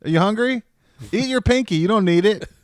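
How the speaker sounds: high, loud goofy commands; silly yelling at a kid. Retold or imitated. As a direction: boss the bored kid around loudly. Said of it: "I was like".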